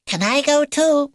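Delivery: very high, squeaky plea; baby beaver, pleading. Acted. Baby beaver asking to join the mission, squeaky and eager. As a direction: pipe up hopefully, pitched right up.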